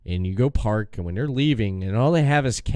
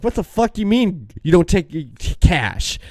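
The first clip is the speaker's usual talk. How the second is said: retold angry outburst; 6 semitones higher than usual. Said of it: "It just started going off on 'em".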